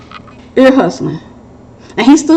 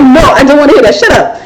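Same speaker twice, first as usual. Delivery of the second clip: laughing mock protest; husband protesting; 4 semitones higher, 4 dB louder than usual. Husband refusing to hear about her past, laughing. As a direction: playfully beg her to stop, laughing. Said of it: "he was like".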